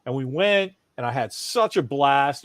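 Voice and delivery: usual speaking voice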